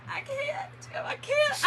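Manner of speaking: high, squeaky mock laugh-cry; imitated helpless laughter. Wife retelling her plea to Jim, imitated tearful laughter. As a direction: act out gasping, too tickled to stop.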